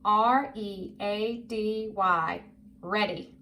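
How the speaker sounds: slow, letter by letter, then the word; measured spelling drill, each letter apart. Shown as a demonstration. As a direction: spell it slowly for a young reader. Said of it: "Do it with me".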